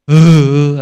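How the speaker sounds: two short loud sobbing cries, showing how one weeps; mock weeping. Shown as a demonstration. Preacher shows the congregation how Jesus wept, with loud sobs. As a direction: sob out loud to demonstrate crying. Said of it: "¿Usted cómo llora?".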